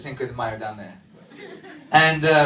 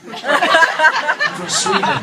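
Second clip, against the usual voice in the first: slow, drawn-out and much higher, laughing; exasperated correction; 12 semitones higher, 4 dB louder, much slower than usual. Real, not acted. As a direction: stretch it out in disbelief while laughing.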